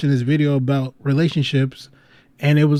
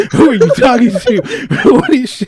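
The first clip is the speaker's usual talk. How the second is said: louder, higher, laughing mock outburst; mock angry; 9 semitones higher, 6 dB louder than usual. Imagining the mother's reaction to the call, laughing mock rage. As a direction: play her blowing up, laughing through it. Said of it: "She's like".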